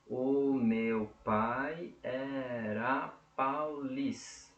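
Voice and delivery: very slow, stopping on "lis" of "paulista"; syllable-by-syllable counting. Shown as a demonstration. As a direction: draw out each syllable up to the stressed one. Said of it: "Sete sílabas".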